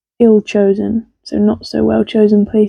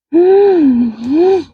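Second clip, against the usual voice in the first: excited gasp; 7 semitones higher than usual. Acted. Puppet reacts to book.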